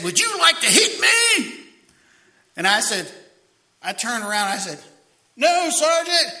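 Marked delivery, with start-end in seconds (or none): 0.0-1.5 high, loud mocking challenge; taunting yell. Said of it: "he said". 5.4-6.3 high, loud no, Sergeant; crisp shouted answer. Retold or imitated. Recruit answering at attention.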